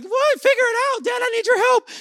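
put-on high, loud kid outburst; shrieky mock disbelief and pleading; indignant child. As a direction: mimic a child protesting to dad. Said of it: "they like, "Dad".

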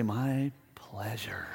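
slow hushed whisper; slow whispered my pleasure. Pretend. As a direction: breathe it out softly. Robbed staff still politely reply.

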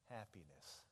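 normal talking voice

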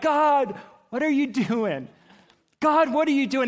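loud, high, anguished demand; retold screaming. Father to God as his son is taken away, screaming. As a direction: cry out to God in protest. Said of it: "and I'm screaming".

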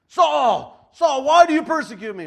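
huge echoing shout from heaven. Retold or imitated. Preacher booming Jesus's call to Saul, a huge shout. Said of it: "I don't know how Jesus said that".

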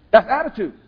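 "That's attitude" hit loud and high; loud punchline. Real, not acted. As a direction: land "That's attitude" like a hammer. Punchline of grocery store story.

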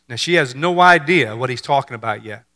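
ordinary delivery

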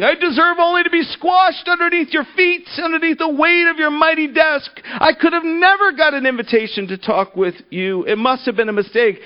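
high, pleading self-abasement; groveling. Acted. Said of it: "the man went on groveling".

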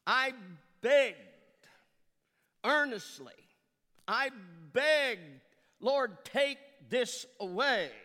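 loud, pleading cry, slow at the end; earnest pleading. Acted. Paul begging the Lord to take the thorn, loud pleading. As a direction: beg the Lord with full force.

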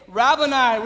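a sudden loud cry of recognition. Acted. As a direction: call the name out loud, overwhelmed. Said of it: "cried out in Aramaic".

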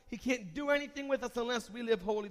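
usual speaking voice

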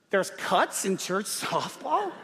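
slow, incredulous rising question; mock shock at the very idea; shocked disbelief. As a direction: stretch it out as a baffled question. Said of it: "look at each other in shock".

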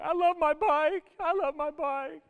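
put-on high, loud child's wail fading off; a dad imitating his crying daughter; crying little girl. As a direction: wail it like a tearful five-year-old. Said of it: "she's still crying".

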